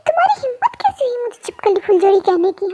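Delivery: a furious whispered challenge; angry whisper. Acted. Girl confronting the boy who insulted her, angry whisper. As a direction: hiss the accusation under your breath.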